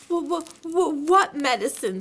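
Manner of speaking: high stuttered question; stammering dread. A narrator's reading. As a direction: stammer in alarm. Piglet dreading medicine.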